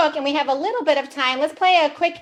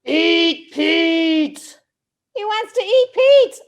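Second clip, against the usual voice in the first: high, loud gleeful growl; hungry monster; 4 semitones higher, 5 dB louder, slower than usual. Acted. Storyteller voicing the monster craving his friend. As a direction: relish the word eat like a hungry beast.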